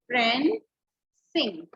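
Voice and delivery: two separate beats, friend - Singh; split syllables. Shown as a demonstration. Teacher showing two sounds, friend and Singh held apart. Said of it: "friend में एक sound आई और सिंग में दूसरी".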